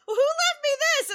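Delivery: high loud puzzled ask; a retold surprised question. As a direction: ask as if baffled. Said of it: "he's like".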